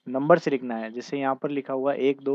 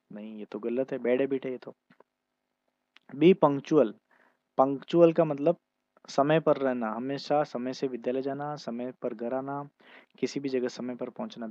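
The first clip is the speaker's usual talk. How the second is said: ordinary delivery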